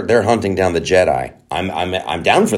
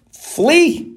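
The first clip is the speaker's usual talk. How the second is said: squealed high in disbelief; shocked reveal. Real, not acted. Revealing the villain to viewers, disbelieving squeal. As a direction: say it like you can't believe it.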